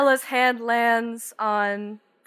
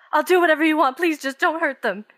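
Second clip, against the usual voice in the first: higher and louder, begging; pleading father; 6 semitones higher, 4 dB louder, faster than usual. Acted. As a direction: give in to save the daughters.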